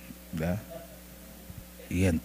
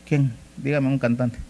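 ordinary talk, nothing marked

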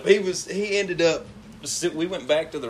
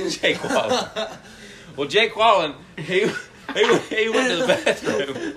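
laughing; 6 semitones higher than usual. Real, not acted. Laughing at made-up name.

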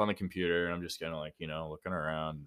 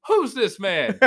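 high, loud curious voice; second clerk asking; 14 semitones higher, 8 dB louder, slower than usual. Retold or imitated. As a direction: play the coworker coming out back. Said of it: "She goes".

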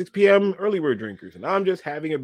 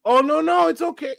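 imitating the girl; 13 semitones higher, 4 dB louder than usual. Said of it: "The girl's like".

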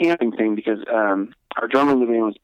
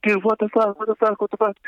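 mock panic; 9 semitones higher than usual. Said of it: "everybody was just, like, screaming".

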